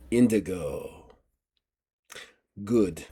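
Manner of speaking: low, quiet hint of the old swordsman; uncle's voice. Uncle greeting grown relative as he turns round, lightly voiced and quiet. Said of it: "make him use the accent".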